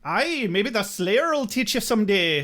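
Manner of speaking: hammed-up fake Irish lilt; mock bad Irish accent. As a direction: make fun of a shitty Irish accent. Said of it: "I'm just making fun of Angel's shitty accent".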